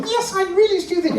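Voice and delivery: high squeaky puppet voice; Minnie Paul. Acted. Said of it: "aren't you, Minnie Paul?".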